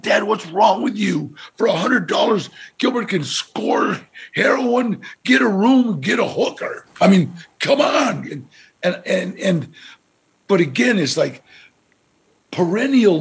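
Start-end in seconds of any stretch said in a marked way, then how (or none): 0.0-8.3 upset daughter. Retold or imitated. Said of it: "she got so upset with me".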